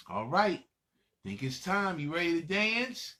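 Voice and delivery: normal talking voice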